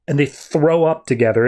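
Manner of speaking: normal talking voice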